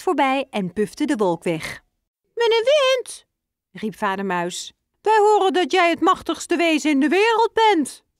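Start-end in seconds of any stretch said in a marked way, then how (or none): 2.3-8.0 very high, squeaky little mouse voice; high mouse voice ending in a piep; squeaky father mouse. Acted.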